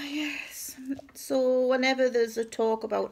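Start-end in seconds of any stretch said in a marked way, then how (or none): 0.1-0.8 whispered yes. Real, not acted. Rueful ending.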